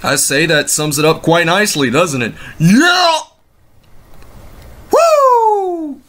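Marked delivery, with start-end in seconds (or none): 2.6-3.2 high yelled wrestler call; Ric Flair impression. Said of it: "Ric Flair impersonation". 4.9-6.0 wrestler's signature woo; Ric Flair woo. Retold or imitated. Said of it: "Ric Flair impersonation".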